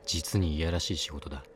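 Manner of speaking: usual speaking voice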